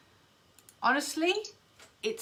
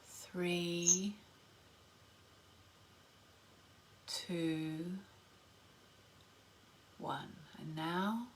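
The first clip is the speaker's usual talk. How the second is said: whispered, slow guided meditation; slow soothing countdown; 4 semitones lower, 12 dB quieter, slower than usual. Acted. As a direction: count them down softly into stillness.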